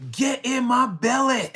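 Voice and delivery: loud, high, drawn-out movie-catchphrase voice; crude slang quote. Acted. Mocking what the smart seducer would never say, hammed-up loud yell. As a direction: bellow it slowly, hammed right up. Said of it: "say it like that".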